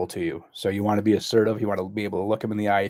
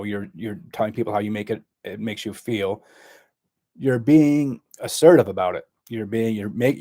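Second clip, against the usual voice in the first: ordinary talk, nothing marked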